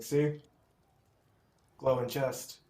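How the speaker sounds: high, eager, pointing it out; excited. Acted. Player acts character pointing out chest.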